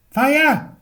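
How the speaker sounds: high, loud shriek of alarm; panicked housewife yelling fire; cry of alarm. Acted. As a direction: scream it as the house fills with smoke. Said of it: "cried Missus Prothero".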